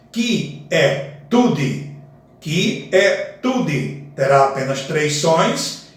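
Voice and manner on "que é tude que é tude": slow "que é tude" as a sung-out line ending; slow poetic scansion. Shown as a demonstration. Teacher to students scanning the line end slowly. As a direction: drawl "que é tude" out, syllable by syllable. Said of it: "se tiver no fim do verso".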